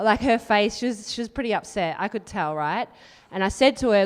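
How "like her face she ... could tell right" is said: ordinary talk, nothing marked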